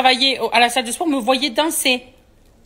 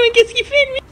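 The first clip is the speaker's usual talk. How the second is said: high, louder puzzled neighbour voice; mock neighbour; 3 dB louder than usual. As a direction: squeak the neighbour's bafflement. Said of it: "le voisin, il va se dire".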